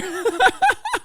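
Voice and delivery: high burst of real laughter. Real, not acted. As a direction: crack up high. Laughing at moderator quote.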